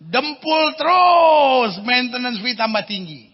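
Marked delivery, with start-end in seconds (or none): 0.0-1.8 high, loud, slowed rant; comic shout. Acted. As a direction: rail at the old car for laughs. Comic rant about old car. (teriakan lucu tentang mobil tua)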